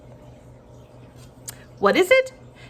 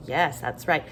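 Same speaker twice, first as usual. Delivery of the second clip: ordinary talk, nothing marked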